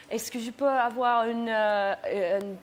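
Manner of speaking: lightly voiced hesitant school French, searching for words; halting school French. A hint of halting French, asking a Paris cabbie. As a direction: fumble through school French. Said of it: "her character impressions make it hilarious".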